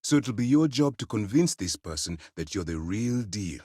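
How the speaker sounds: ordinary delivery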